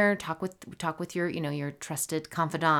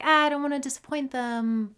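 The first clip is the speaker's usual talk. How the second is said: acting out an anxious inner voice, pitched up; twisted up; 9 semitones higher, 5 dB louder than usual. Host voicing a listener who dreads disappointing a caller, twisted up. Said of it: "you feel all twisted up".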